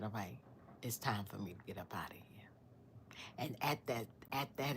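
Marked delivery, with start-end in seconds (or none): 0.0-2.2 hushed, quick whisper; whispering to herself. Retold or imitated. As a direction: breathe it out fast and low. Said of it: "I said".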